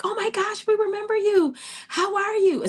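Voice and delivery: very high, mock-delighted greeting; gushing staff impression. Staff recognising the returning boy, high gushing imitation.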